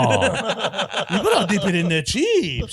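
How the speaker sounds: put-on high, slow Sydney wog accent, laughing through it. A comedian imitating a Sydney wog offering chips, hammed-up Sydney wog accent. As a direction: ham up the Aussie wog accent. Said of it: "they all talk like that".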